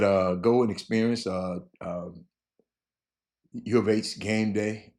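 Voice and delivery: ordinary talk, nothing marked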